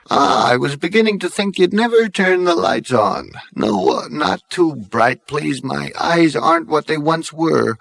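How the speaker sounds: put-on high, frail old man voice; ancient great-grandfather. Dead great-grandfather greeting Zaphod, frail and old. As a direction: quaver like a very old ghost.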